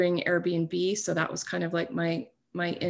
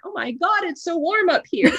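surprised; 10 semitones higher, 6 dB louder than usual. Retold or imitated. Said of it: "I was like".

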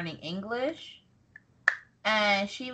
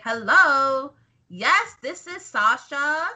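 Sasha Banks; 3 semitones higher, 3 dB louder, slower than usual. Acted. Sasha introducing herself to a caller, slow affected impression. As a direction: draw out each word like a posing celebrity. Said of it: "my Sasha impression is spot on".

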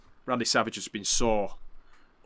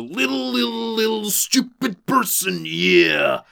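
high, loud, slow wrestler rant; Macho Man impression; 12 semitones higher, 8 dB louder, slower than usual. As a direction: shout it like an unhinged wrestler. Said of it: "Macho's response is".

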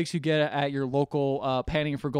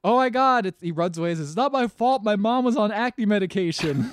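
high, loud frantic excuse; hammed-up panicked excuse; mock panicked whine; 6 semitones higher, 6 dB louder than usual. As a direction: whine it while running away. Horstick fleeing, retold.

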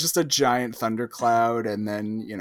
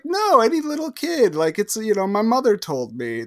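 high, louder mock insistence; retold insistence; 10 semitones higher, 6 dB louder than usual. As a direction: protest in a raised, imitating voice. Retold exchange with a questioner.

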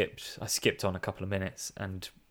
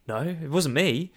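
mock denial; 6 semitones higher, 4 dB louder than usual. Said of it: "the other one's like".

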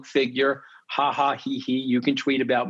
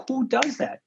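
incredulous; 11 semitones higher than usual. Real, not acted. Outraged about attack on wife.

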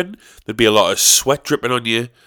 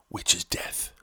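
whisper; 5 semitones lower, 12 dB quieter than usual. Real, not acted. Dark aside about death.

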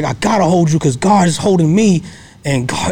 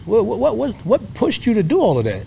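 a father's high, probing demand for answers; high-voiced dad interrogating his son; stern father questioning; 9 semitones higher than usual. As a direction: grill the returned son like a worried dad. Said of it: "Dad had some questions".

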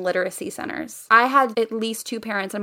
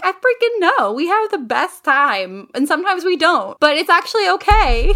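high and bubbly; mock cheerful teacher; excited teacher voice; 6 semitones higher than usual. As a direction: gush to the parents like a cheery host. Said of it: "And I'm like".

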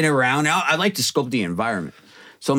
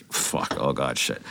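much lower and softer, a dismayed breath; quiet retold panic at the news; hushed panicked swearing; 8 dB quieter, slower than usual. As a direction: swear under your breath in dread. Learns the crowd are thieves.

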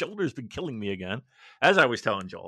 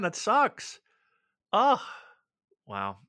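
normal talking voice

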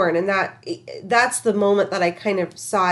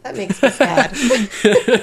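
mock sad; 3 semitones higher, 4 dB louder, much slower than usual. Real, not acted. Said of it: "Just sad".

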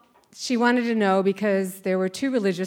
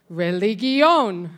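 loud, drawn-out imitation of the teacher; louder, insistent voice; 5 dB louder, much slower than usual. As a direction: repeat it slowly and loudly, as if volume explains it. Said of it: "in this louder voice".

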